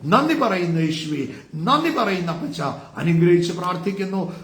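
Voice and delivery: rising, urgent preacher's prayer, building in pitch; fervent intercession prayer. Acted. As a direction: pray over the congregation with growing fervour.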